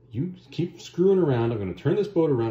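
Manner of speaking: hammed-up telling-off; mock parental scolding. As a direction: imitate a fed-up parent warning the kids. Conan scolds crew like squabbling kids.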